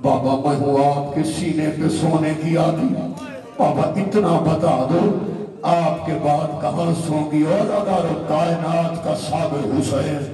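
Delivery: normal talking voice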